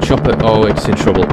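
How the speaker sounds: calling out as the chopper falters; loud, urgent excitement; excited alarm. Real, not acted. Watching chopper in trouble.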